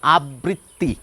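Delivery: আবৃত্তি with natural doubled tt, abritti; everyday educated আবৃত্তি with tt. Shown as a demonstration. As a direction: common Bengali habit. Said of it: "শব্দটির উচ্চারণ করে থাকেন".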